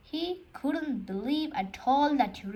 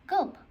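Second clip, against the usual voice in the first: a high, loud gulp of alarm; cartoon gulp, pitched up; nervous gulp; 8 semitones higher, 4 dB louder than usual. A narrator's reading. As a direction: gulp it out, suddenly scared. Narrator gulps in shock.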